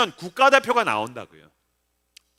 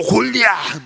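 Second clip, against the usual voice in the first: slow, exaggerated heave on the name; hulking giant; slower than usual. Pastor showing how huge the giant's fist is, hammed-up bigness. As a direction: stretch the word out to giant size.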